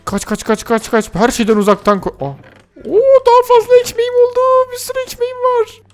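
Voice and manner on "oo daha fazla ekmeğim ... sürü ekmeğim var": much higher, squeaky gloating voice; squeaky gloat over loot; gleeful squeal. Acted. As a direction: squeal like a greedy kid over treasure.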